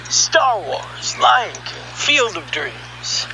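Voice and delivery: ordinary delivery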